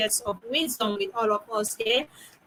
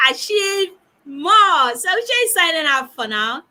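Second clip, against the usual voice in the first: rousing sign-off; 6 semitones higher, 6 dB louder than usual. Real, not acted. Host rallying the panel as she signs off, rousing. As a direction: stretch it out loud for everyone.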